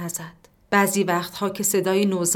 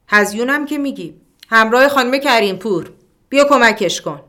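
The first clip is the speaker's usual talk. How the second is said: nurse calls across the ward, louder and higher; calling out loudly; 5 semitones higher, 8 dB louder than usual. Acted. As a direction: shout for the companion to come help. Nurse calls companion over.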